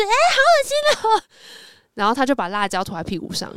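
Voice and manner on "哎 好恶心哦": very high squeal of disgust; shrill-pitched girly eww; grossed-out girls. Retold or imitated. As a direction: squeal it like schoolgirls seeing something gross. Schoolgirls react to bare bottom.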